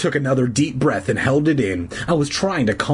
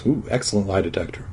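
ordinary delivery

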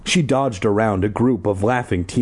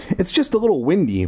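light carefree voice; 8 semitones higher than usual. Acted. Paul shrugging it off.

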